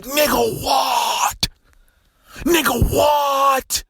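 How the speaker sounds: high-pitched, stunned outburst; incredulous shout. Retold or imitated. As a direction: shout it in exaggerated disbelief. Retold disbelief at friend's claim.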